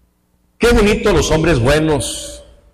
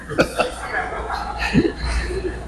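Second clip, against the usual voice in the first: laugh; 8 dB quieter than usual. Retold or imitated. Father quote laughing.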